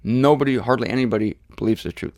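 ordinary talk, nothing marked

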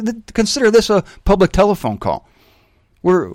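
ordinary talk

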